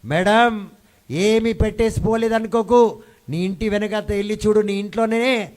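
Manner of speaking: loud, high preacher voicing the prophet; prophet addressing his wife. Prophet tells his wife to look at home, loud and emphatic. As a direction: call out emphatically as the reassuring husband. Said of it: "అతను అంటున్నాడు తన భార్యతో".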